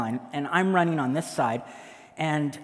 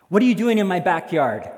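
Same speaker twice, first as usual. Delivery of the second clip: loud, raised-pitch challenge; stern demand; 4 semitones higher, 8 dB louder than usual. Acted. Homeowner confronting intruder in the park, stern demand. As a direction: demand an answer loudly.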